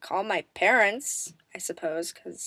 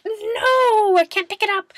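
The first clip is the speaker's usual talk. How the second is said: high complaining mock whine; hammed-up weak whine; whiny struggle; 13 semitones higher, 6 dB louder than usual. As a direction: pretend straining under the weight. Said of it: "and I'm like".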